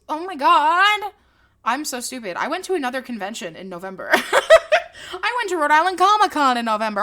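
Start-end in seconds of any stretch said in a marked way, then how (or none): 0.1-1.1 loud, high, drawn-out dismay; shocked realisation. Real, not acted. Realising a forgotten November convention, loudly aghast. 4.1-7.0 high, loud, through laughter; laughing disbelief. Real, not acted. Podcaster remembering a forgotten convention, laughing in disbelief.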